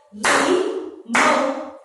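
"Limão" slowly split as Li, pause, mão; syllable by syllable. Shown as a demonstration. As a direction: count out "Limão" in two long beats. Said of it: "Vamos ver quantas sílabas".

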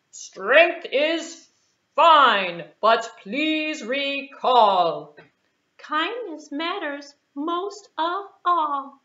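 slow rhyming moral; moral recited. A narrator's reading. Librarian giving the children the moral, slow and rhyming. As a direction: recite the lesson slowly.

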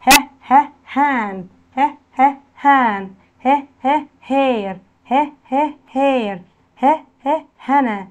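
"ها، ها" in short beats, then the full word; phonics drill. Shown as a demonstration. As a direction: drill the H sound twice, word falling after it. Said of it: "كلمات على حرف ال H". (تدريب صوتي: "ها، ها" منفصلة ثم الكلمة)

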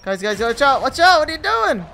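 loud, high alarmed callout; urgent shout. Real, not acted. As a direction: yell a warning to teammates. Warning teammates mid-fight.

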